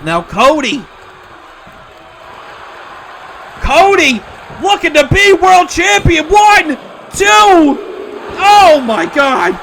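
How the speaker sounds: high, loud, drawn-out commentator calls; excited play-by-play. Acted. Commentator calling a near fall to viewers, loud and excited. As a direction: call the big moves like a hyped announcer.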